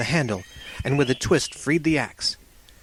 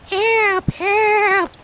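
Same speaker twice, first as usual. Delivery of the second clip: very high, loud cries for help; a tiny fire voice calling out; crackly cry for help; 5 dB louder than usual. A narrator's reading. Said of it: "crackly voice crying".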